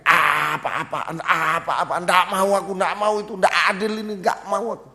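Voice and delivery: loud, high protest, rising as it goes; re-enacted shouting tantrum. Preacher re-enacts raging alone at home, shouted tantrum. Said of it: "Saya teriak-teriak".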